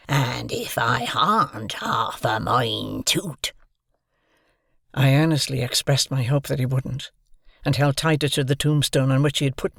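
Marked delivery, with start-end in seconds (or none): 0.1-3.5 slow, hoarse menacing whisper; threatening convict whisper. A narrator's reading. Convict threatening to eat the boy, slow menacing whisper.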